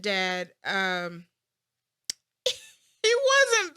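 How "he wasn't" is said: nervous laugh. Real, not acted. Laughs before a hard answer.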